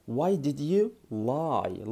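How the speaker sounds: very slow, word by word; slow classroom model; slow teaching. Shown as a demonstration. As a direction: spell it out slowly for learners. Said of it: "وتحط بقى".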